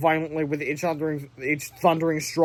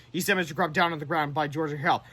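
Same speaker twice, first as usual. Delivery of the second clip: plain reading voice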